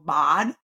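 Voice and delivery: "bod" with a low-jaw ah for eh; vowel distortion. Shown as a demonstration. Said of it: "a neutral vowel is taken to lower jaw".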